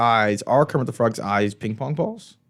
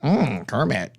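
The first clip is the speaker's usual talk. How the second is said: Kermit the Frog voice; much slower than usual. Retold or imitated. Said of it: "good Kermit the Frog impression".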